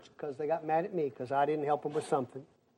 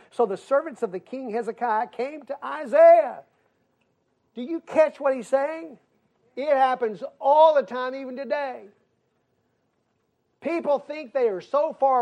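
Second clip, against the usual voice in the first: raised, higher-pitched sermon delivery; forceful preaching; 5 semitones higher, 5 dB louder than usual. Real, not acted. Preacher urging people to pray themselves, forceful preaching. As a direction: drive each point home to the congregation.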